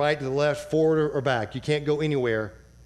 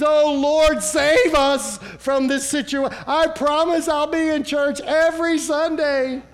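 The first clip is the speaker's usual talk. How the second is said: high, loud, pleading bargain with God; hammed-up begging prayer, slowing on the last plea; mock desperate prayer; 12 semitones higher, 6 dB louder than usual. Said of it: "he doesn't fall to his knees".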